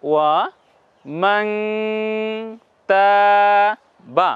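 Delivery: ওয়া মাং তা বা said syllable by syllable, মাং nasal; slow syllable demo. Shown as a demonstration. Tutor modelling recitation syllable by syllable. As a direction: spell it out slowly with a nasal মাং. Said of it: "উচ্চারণটা কেমন হচ্ছে".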